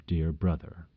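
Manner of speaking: plain reading voice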